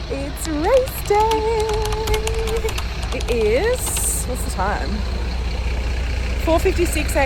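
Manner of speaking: loud, slow, thrilled announcement; excited. Real, not acted. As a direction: belt it out with race-day joy. Race morning, thrilled announcement.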